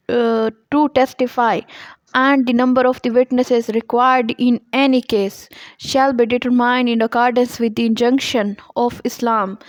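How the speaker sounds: reading law text slowly and deliberately; measured dictation pace for students; slow statute reading. Acted. Reads statute text aloud. (धीमे-धीमे क़ानून की इबारत पढ़ना)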